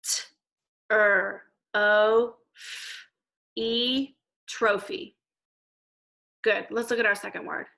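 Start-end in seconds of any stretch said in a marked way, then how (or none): 0.0-5.2 each sound said apart, then blended into trophy; teacher segmenting sounds then blending trophy. Shown as a demonstration. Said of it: "let's go to the beginning of the word".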